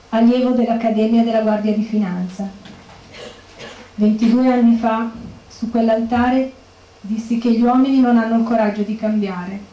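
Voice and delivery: ordinary talk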